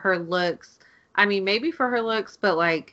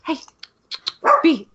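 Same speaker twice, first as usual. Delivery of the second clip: high sing-song coaxing to a dog; pet talk; 7 semitones higher than usual. Real, not acted. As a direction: coax the dog over in a high voice.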